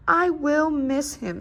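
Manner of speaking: slow, mild sniffly sadness; a touch of tearful missing; sniffly sad. A narrator's reading. Squirrel watching the farmer take the scarecrow, slow and mildly sad. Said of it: "sniffed Squirrel".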